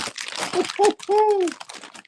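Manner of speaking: high-pitched burst of giggles. Real, not acted. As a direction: let a high squeal of laughter out. Laughing hard at himself.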